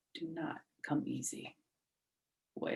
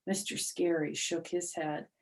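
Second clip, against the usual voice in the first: ordinary delivery, nothing marked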